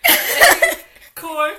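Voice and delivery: laughing. Real, not acted. Laughing, can't get word out.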